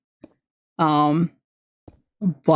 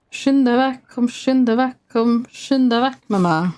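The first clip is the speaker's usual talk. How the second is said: sung in a broad Norwegian dialect, pitched higher; 4 semitones higher than usual. Shown as a demonstration. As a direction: lilt it in the singer's home Norwegian dialect. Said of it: "that was very much in my dialect".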